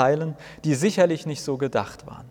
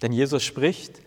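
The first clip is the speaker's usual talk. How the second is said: ordinary delivery, nothing marked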